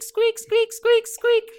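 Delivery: rubbery high squeaky toy. Acted. Wagon wheels squeaking.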